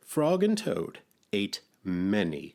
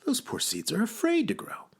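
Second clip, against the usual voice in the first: high-pitched storybook character; Frog; 14 semitones higher than usual. A narrator's reading. Frog pities Toad's seeds, high character voice. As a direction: explain it in a high creature voice.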